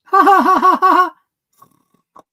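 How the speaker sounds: loud, high, staccato mock laugh; imitated laughter. Wife mimicking Daniel laughing on the phone, loud mock laugh. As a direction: punch out separate ha-has, mimicking his laughter.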